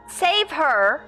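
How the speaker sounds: ordinary talk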